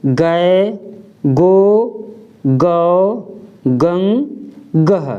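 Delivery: each syllable from ग to गह said apart and clearly; slow syllable drill from ग to गह; ga barakhadi. Shown as a demonstration. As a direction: recite the matra row for ga. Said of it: "अब ख को देखते हैं".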